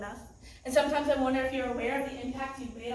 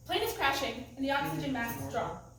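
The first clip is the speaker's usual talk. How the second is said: ordinary talk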